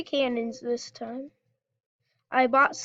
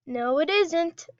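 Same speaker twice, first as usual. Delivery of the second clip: usual speaking voice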